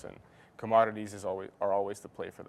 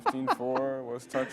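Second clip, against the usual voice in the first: police radio; 4 semitones higher, slower than usual. Pretend. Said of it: "like a police radio call out".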